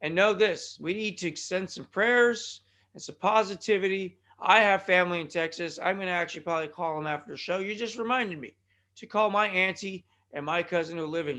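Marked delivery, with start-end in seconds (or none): none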